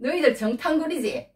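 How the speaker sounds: high, insistent accusing question; pressing accusation. Acted. Brother in power cornering his brothers as spies, pressing. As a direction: drive the accusation at them. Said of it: "똑같이 몰아붙이는 거야".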